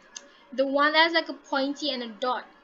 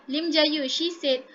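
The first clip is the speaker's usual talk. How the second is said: ordinary talk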